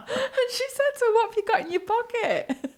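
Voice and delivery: high, squeaky voice through laughter; helpless laughter, high and soft; laughing while talking. Real, not acted. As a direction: barely get the quote out for giggling. Teller cracks up retelling search.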